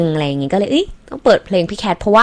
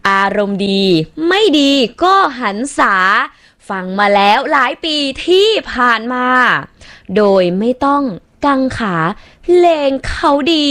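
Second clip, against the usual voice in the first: recited verse; 6 semitones higher than usual. Acted. Reads a listener's rhyming verse.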